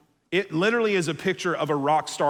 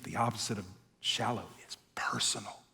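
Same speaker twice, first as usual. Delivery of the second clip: softly whispered punchline; hushed sermon climax; 8 semitones lower, 12 dB quieter than usual. Real, not acted. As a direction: breathe it as a secret. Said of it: "whispered key line".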